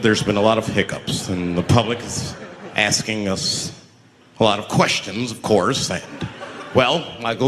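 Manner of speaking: low, formal official's voice; government official. Retold or imitated. Official briefing Michael in a meeting, low formal tone. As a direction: deliver the bad news like a bureaucrat. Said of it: "Do you have meetings".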